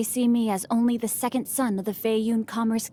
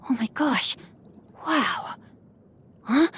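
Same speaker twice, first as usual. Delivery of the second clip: hushed, breathless oh my gosh; a startled whisper to yourself; whispered gasp; 2 semitones higher than usual. Acted. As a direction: gasp it under your breath in surprise. Gasping surprise at a book.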